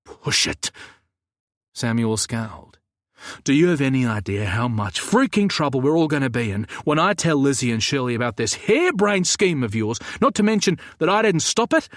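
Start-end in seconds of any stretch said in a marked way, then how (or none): 0.0-0.7 curt warning. Acted. 3.4-11.9 louder, rising into a higher furious end; angry rant. Acted. Samuel ranting about the harebrained scheme, building louder and higher.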